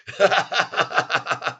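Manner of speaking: real burst of laughter; long run of rapid ha-ha laughter; hearty laugh. Real, not acted. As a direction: crack up at your own joke. Cracking up at his own joke.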